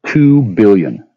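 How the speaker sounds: slow, drawn-out "two" with falling tune; slow stressed figure. Real, not acted. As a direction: drag "two" out bitterly. Repeating the sum again.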